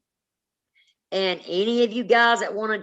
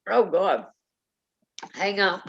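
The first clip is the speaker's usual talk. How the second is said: ordinary delivery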